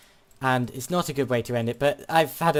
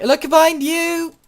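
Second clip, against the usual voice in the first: mock alarm; 13 semitones higher, 5 dB louder, slower than usual. Fake warning, then "just joking.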